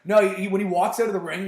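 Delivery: ordinary talk, nothing marked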